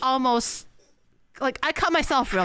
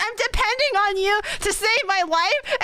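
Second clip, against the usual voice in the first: pleading; 10 semitones higher than usual. Retold or imitated. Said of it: "I'm like".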